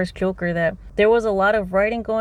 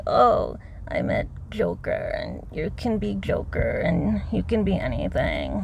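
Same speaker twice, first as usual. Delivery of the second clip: mocking vocal fry; 4 dB quieter than usual. Vlogger mocking the singer's voice, in a creaky vocal fry. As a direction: drag the words out in a mocking fry. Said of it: "[name]'s horrible vocal fry voice".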